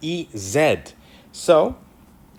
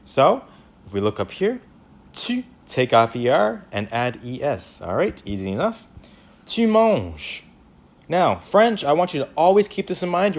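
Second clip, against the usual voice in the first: ordinary delivery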